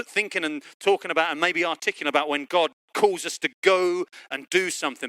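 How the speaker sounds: ordinary delivery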